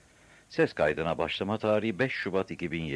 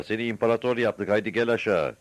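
high, raised-voice announcement; shouted coaxing; 5 semitones higher, 5 dB louder than usual. A narrator's reading. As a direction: call up and urge him down. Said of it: "diye bağırdılar".

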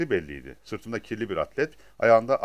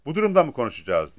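raised, mildly aggrieved question; a touch of protest; complaining; 4 semitones higher, 6 dB louder than usual. A narrator's reading. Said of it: "diye yakındı". (hafifçe yakınarak)

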